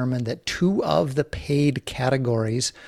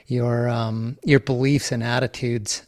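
ordinary delivery

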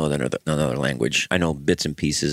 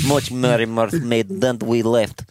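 put-on Russian accent; 4 semitones higher, slower than usual. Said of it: "I am learning how to do this voice".

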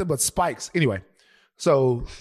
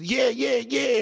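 retold full-on yeahs; 8 semitones higher, slower than usual. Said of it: "He was full on".

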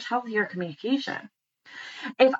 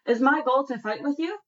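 ordinary delivery

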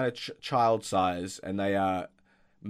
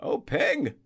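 put-on high, loud, distraught old man; fretting groundskeeper; 5 dB louder than usual. Groundskeeper finding his friend Peng hurt, distraught high voice. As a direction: panic over your injured friend.

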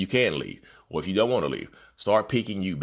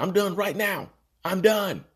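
much higher, emphatic declaration; mock decisive; 11 semitones higher, slower than usual. Imagining quitting the narcissist all at once, high and emphatic. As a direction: fling it out like you're finally free.

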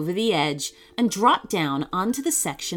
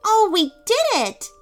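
high, louder triumphant cheer; beaming; 13 semitones higher, 5 dB louder than usual. Acted. Mira telling the sloth of their success, beaming. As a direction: burst with pride. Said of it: "beamed Mira".

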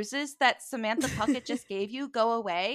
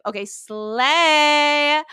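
drawn-out slay; 5 semitones higher, 6 dB louder, much slower than usual. Real, not acted. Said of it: "I was like".

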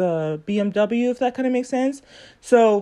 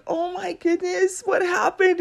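concerned surprise; 9 semitones higher than usual. Retold or imitated. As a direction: react with sudden worry. Her concerned reply on the call.

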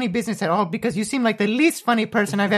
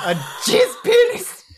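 incredulous shout; 12 semitones higher than usual. Real, not acted. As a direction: shout it in disbelief. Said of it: "You heard it here first".

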